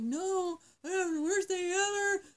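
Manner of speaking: high, loud mock sobbing wail; imitating a hysterical cry. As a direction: wail it through tears, hammed-up. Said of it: "I'm crying. I'm hysterical".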